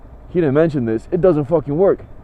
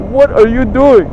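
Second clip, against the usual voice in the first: higher, louder outraged question; exasperated; 6 semitones higher, 5 dB louder than usual. Retold or imitated. Fed-up buyer confronting the seller, exasperated. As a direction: demand it in quick exasperation. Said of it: "that really pissed me off".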